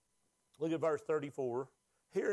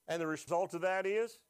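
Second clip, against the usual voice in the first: normal talking voice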